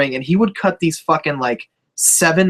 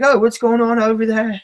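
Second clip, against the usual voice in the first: higher-pitched mock English accent; put-on English accent; 6 semitones higher than usual. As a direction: imitate her with a silly English accent. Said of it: "Why did A J have the accent?".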